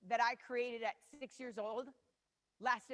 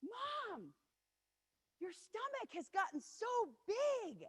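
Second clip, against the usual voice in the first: put-on high, slow child's voice; little daughter; 11 semitones higher, much slower than usual. Four-year-old daughter in the shower to mom, high slow toddler voice. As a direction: talk like a wide-eyed toddler. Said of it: "turns around and says".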